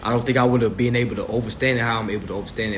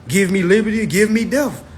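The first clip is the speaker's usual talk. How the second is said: courtroom declaration; 7 semitones higher, 4 dB louder than usual. Shown as a demonstration. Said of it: "That's how I would say it".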